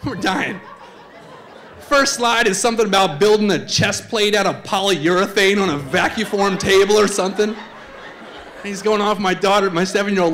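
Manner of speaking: normal talking voice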